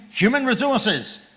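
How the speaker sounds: ordinary talk, nothing marked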